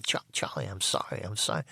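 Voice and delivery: soft, near-whispered pleading sorry; whispered scared apology. Retold or imitated. Pinned against the wall, he whispers a scared apology to Charlie. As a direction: beg forgiveness under your breath.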